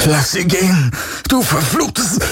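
high, strained furious cry; tight, high-pitched fury cut short. A narrator's reading. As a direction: burst out in desperate rage. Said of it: "sein Zorn weißglühend".

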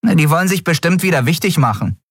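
put-on high, quick boyish voice; boy character. Boy brushing off the patrol ship, boyish and quick. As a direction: rattle it off as a boy.

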